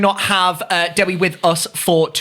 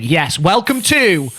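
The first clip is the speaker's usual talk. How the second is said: dramatic announcer; 5 semitones lower, slower than usual. Acted. Announcing the show title.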